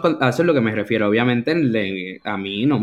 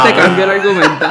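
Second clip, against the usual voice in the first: laughing; 7 semitones higher, 6 dB louder, faster than usual. Real, not acted. Laughing while arguing about scene.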